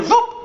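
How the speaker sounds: high whooshing zoop for lift-off; zoop take-off noise. Acted. Ducks lift off into the sky, high zoop. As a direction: squeak a quick high take-off sound.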